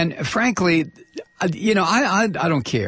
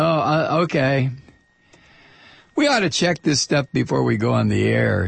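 ordinary delivery

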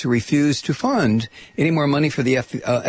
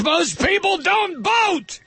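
slow outraged shout, much higher; indignant shout; slower than usual. Real, not acted. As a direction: yell it in disbelief. Outraged rant peak.

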